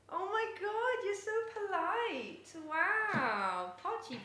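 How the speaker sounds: high, slow, drawn-out "polite". Real, not acted. Owner praises her cat Pochi, slow high baby talk. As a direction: praise the cat in a slow sing-song coo.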